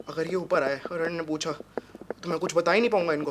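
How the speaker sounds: low, hushed thinking aloud; worried self-talk. Acted. Man to himself as guests approach, hushed worry. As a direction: think it through under your breath.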